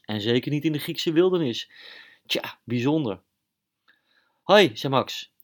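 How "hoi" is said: higher, louder bright hoi from Max; loud cheery hello from the mouse hero; cheerful hero greeting. Acted. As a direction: greet like a jolly superhero.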